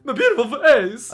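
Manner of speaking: high, loud hammed-up wail over his face; mock anguish. To viewers, mourning his gashed face in a hammed-up, high, loud wail. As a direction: wail it like a vain hero mourning his looks.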